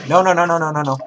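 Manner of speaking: loud, high panicked cries. Real, not acted. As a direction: shout it in real panic. Panicked under fire in game.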